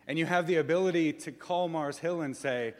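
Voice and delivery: ordinary talk, nothing marked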